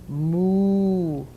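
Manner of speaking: long, drawn-out moos; imitated cattle lowing. A narrator's reading. Cattle stuck in the road, long lowing moos. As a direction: stretch each moo out like a cow. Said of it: "went the cattle".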